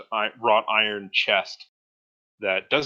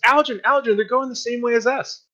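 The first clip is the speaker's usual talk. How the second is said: high, loud and eager; girl shouting to her companion about a ride; excited calling; 9 dB louder than usual. Acted. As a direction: call out to a friend, pointing at the caravan. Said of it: "She says".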